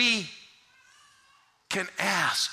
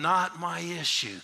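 ordinary delivery

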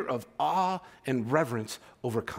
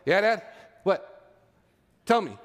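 a bit louder, impatient eye-roll reply; mock the bored, put-upon son; sassy kid; 2 semitones higher, 3 dB louder, slower than usual. Son answers dad's call with mock-sassy impatience. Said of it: "I get the eye roll".

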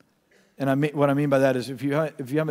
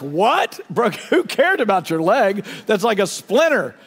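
higher, louder incredulous wife; mock indignant wife; 5 semitones higher, 4 dB louder than usual. Wife in labour scoffs at husband's broken leg, mock indignant. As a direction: scoff like she can't believe it. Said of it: "She's like".

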